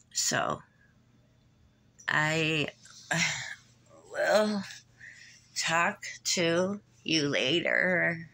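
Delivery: halting, slow sign-off with a sigh; real tight-chested, effortful speech; short of breath. Real, not acted. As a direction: struggle for breath between words, trying to keep calm. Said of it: "I sounded weird there because the breath and the tightness is not helping".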